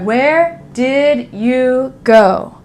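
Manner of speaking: very slow, word by word "Where did you go"; English teacher voice. Shown as a demonstration. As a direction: keep "did" and "you" fully separate. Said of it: "so English teacher voice".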